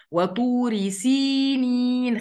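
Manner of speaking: وطوري سينين chanted slowly; slow recitation. Shown as a demonstration. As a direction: recite slowly, hold the long ī. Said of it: "خلوا بالكم من الياء دي".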